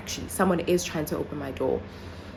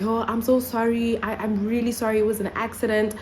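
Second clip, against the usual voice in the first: higher-pitched, imitated apology; apologetic man; 6 semitones higher than usual. Guy apologizes for trying her door, imitated apologetic voice. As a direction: imitate someone pleading that it was a mistake. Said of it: "the guy responds and he's like".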